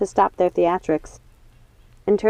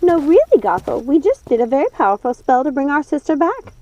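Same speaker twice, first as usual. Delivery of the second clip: raised, urgent sister witch; Lucinda's voice; 7 semitones higher, 4 dB louder than usual. Acted. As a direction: press Gothel to let it go. Lucinda voiced, pleading to stay.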